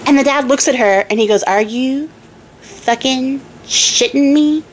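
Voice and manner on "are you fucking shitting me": higher, slow, disbelieving king; outraged king. Acted. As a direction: drag out the outrage, king mode. King outraged at salt answer.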